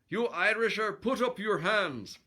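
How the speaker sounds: loud, high Irish-sounding Swede; botched Swedish accent that drifts into Irish; Swedish accent gone Irish. Acted. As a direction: bellow a challenge, accent slipping toward Irish. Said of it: "he sounds like he's Irish".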